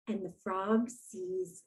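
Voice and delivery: whispered, slow and hushed; suspense whisper. A narrator's reading. Suspense moment in story.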